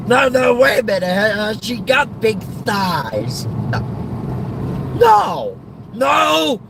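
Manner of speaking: high, insistent comic outburst; excited pushy father. Acted. Father pressing son about the new woman, high excited protest.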